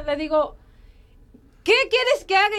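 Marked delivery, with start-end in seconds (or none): 1.6-2.6 high, exasperated demand; retold angry outburst. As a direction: act out being pushed past your limit. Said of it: "o sea, tan enojada".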